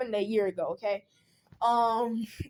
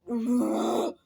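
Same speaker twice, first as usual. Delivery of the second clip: exasperated groan. Retold or imitated. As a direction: groan in retold annoyance. Retold exasperated reaction to mom.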